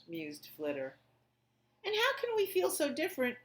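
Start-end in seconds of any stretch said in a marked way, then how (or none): 1.8-3.4 wondering. A narrator's reading. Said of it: "wondered Pip".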